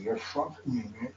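normal talking voice

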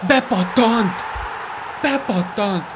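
high, loud goal call; excited goal shout. Real, not acted. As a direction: yell it like a goal celebration. Goal scored in FIFA match.

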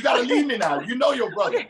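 high, laughing tease; teasing laugh. Real, not acted. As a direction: laugh through the words. Laughing at the host.

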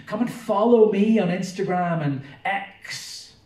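preacher voicing a vain crowd-pleaser; boastful show-off. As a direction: ham up a vain celebrity begging for attention. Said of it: "it's always been about them".

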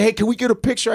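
high, bright fan request; eager kid voice. Retold or imitated. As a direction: ask like an excited teen. Kids asking for photo.

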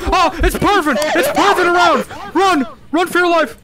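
high, loud alarmed shouting; panicked warning. Real, not acted. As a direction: yell for the team to run. Warning team of roaming ghost.

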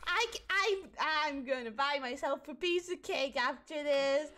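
normal talking voice

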